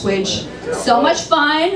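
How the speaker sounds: normal talking voice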